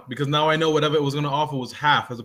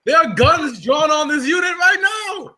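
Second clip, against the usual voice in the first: shouting; 10 semitones higher, 3 dB louder than usual. Acted. Crew threatens the robot.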